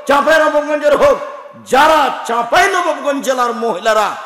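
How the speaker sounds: loud, high ringing appeal; rally the whole district; rousing preaching. Acted. Preacher appealing to Chapainawabganj, shouting with fervor.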